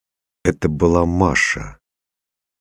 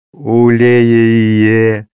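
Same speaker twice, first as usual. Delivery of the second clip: put-on high, loud, long-held toddler vowel; burbling child; 6 semitones higher, 7 dB louder than usual. A narrator's reading. Little daughter tries repeating the word, burbling. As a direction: burble it out, stretching the ending. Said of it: "булькнула Настя".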